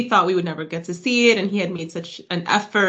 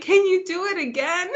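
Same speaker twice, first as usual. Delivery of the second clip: pleading onlooker; 10 semitones higher than usual. Retold or imitated. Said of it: "And they're like".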